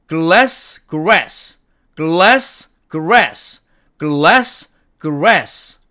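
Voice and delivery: glass then grass, l against curled r; glass with the tongue touching, grass with it curled; l and r contrast. Shown as a demonstration. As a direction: alternate glass and grass, keeping the l and r apart. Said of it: "구분이 척척 잘 되도록".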